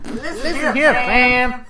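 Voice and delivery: high, loud [name] voice; doing [name]; [name] impression. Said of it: "That's the only one you could do on this list is [name]".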